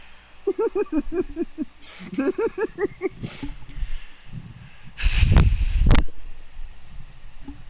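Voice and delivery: long high laughing fit. Real, not acted. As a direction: laugh helplessly until out of breath. Cracking up at bubble gum image.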